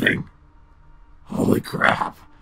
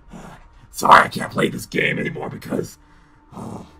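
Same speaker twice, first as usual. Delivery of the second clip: normal talking voice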